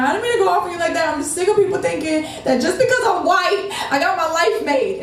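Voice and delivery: high, plaintive, lightly acted tears; retold tearful protest. Worker explaining herself to the customer, mock tearful. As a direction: imitate pleading while crying, no real sobs. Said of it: "And I was crying".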